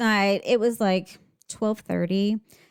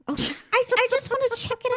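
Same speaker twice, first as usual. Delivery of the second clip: very high, squeaky little plea; playful pleading; much faster than usual. Real, not acted. Mother excusing herself to teasing co-host, playful high pleading. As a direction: plead your case in a high, small voice. Pleads after co-host's reproving "[name].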